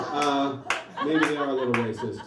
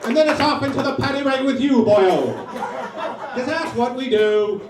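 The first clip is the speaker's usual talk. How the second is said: hammed-up Irish brawler; 8 semitones higher, 6 dB louder than usual. Irish brawler rant ending.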